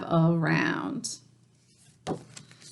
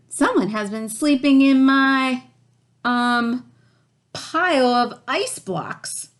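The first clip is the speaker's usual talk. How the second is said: Papa Bear growling; 5 semitones higher, 3 dB louder than usual. A narrator's reading. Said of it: "growled Papa Bear".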